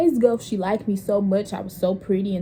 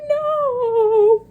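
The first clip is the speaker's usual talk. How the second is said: much higher, louder wailed no; beg, on the edge of tears; tearful no; 14 semitones higher, 7 dB louder than usual. Retold or imitated. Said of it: "I started crying".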